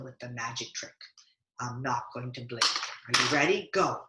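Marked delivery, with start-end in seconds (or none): none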